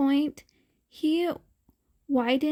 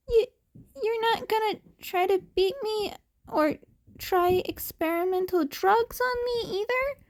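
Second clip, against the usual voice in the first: put-on nervous kid; 9 semitones higher than usual. A narrator's reading. Scared kid pleading.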